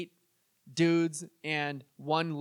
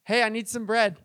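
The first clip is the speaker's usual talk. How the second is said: louder, pestering call at the door; insistent repeat request; 3 semitones higher, 5 dB louder than usual. Acted. Friend knocking a second time, insistent and louder. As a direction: knock and ask more pushily. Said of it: "And he knocks again".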